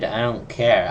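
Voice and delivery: usual speaking voice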